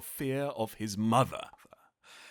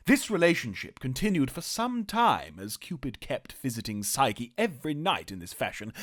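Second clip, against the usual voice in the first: ordinary delivery, nothing marked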